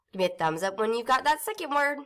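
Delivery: usual speaking voice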